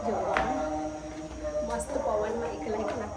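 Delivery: soft, low sneaking-up voice; sneaking hush. Real, not acted. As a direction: creep up on papa quietly. Sneaking up on papa. (પપ્પાને ચમકાવવા ધીમેથી બોલવું)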